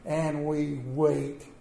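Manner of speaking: slow, weary and we wait; drawn-out waiting. Real, not acted. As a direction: stretch it out to feel the hours. Endless waiting at the hospital.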